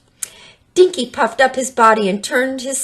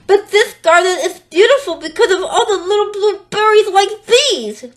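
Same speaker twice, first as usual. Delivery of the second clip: urging; 13 semitones higher, 5 dB louder than usual. Acted. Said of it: "Dinky urged".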